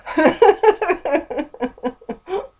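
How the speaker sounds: high nervous burst of laughter. Real, not acted. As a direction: laugh from nerves. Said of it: "a tick again".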